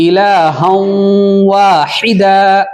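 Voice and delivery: slow chanted واحدا, vowels stretched long; tajweed recitation tune. Shown as a demonstration. Teacher models the tune students must catch, slow chanted recitation. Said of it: "اب اس کو ملاتے ہیں".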